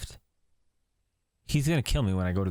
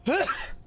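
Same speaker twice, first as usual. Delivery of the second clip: high laugh. Real, not acted. Laughing after sneeze.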